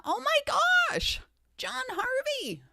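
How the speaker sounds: high-pitched, thrilled call-out; excited greeting. Real, not acted. Streamer spotting a viewer in the chat, excited. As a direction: squeal with delight at a name.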